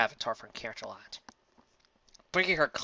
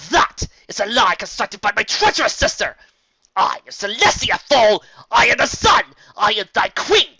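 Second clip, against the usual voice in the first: high, loud screaming fury; a queen's screaming tantrum building to a cry; raging tantrum; 11 semitones higher, 15 dB louder than usual. Acted. Celestia's tantrum, shouting.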